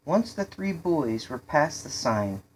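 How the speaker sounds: ordinary delivery, nothing marked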